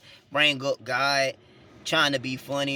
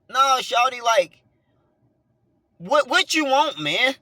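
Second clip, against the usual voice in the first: higher, louder exasperated challenge; exasperated retort; 10 semitones higher, 6 dB louder, slower than usual. Retold or imitated. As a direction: act out confronting her, pitched up and loud. Acting out his exasperated reply to her.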